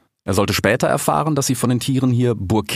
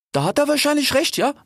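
high, slangy big-cat voice; cheetah Ilias. A narrator's reading. Cheetah concedes to his siblings, high slangy voice. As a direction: pitch it way up, street-kid cheetah.

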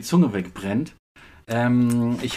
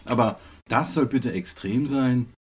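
normal talking voice

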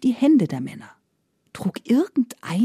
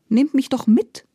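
begging; 6 semitones higher than usual. Acted. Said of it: "bettelte der Iwan".

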